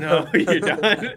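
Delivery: slow, loud, laughing fake rejection; hammed-up talent judge through laughter; laughing mock judge. Pretending to judge his own audition, laughing.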